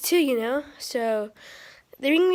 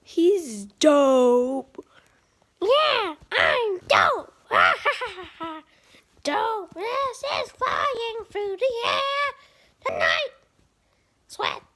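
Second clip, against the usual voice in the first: high, excited bird voice; bird puppet voice; 8 semitones higher, slower than usual. Pretend. The bird puppet showing off, high and excited. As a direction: squawk it up high like a cocky bird. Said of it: "I have a bird".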